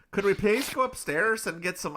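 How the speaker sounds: put-on Scottish-Irish accent. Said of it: "I don't know why he's Scottish now".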